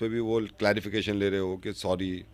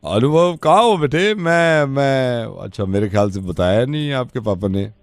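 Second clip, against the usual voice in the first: lightly voiced uncle; 2 semitones lower, 7 dB louder than usual. Prank caller poses as uncle asking son to open the gate, louder. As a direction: pose as a family friend, louder.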